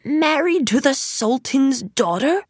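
high, disbelieving question; incredulous. A narrator's reading. Magician hearing of Aladdin's marriage, incredulous. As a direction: voice climbs in disbelief at the news.

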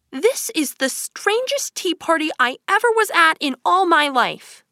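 put-on high, emphatic girl character voice; exasperated Alice. Alice thinking about the odd tea party, high and emphatic. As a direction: marvel at it in a high voice.

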